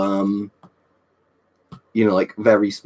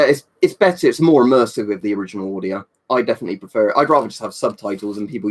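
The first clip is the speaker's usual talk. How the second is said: usual speaking voice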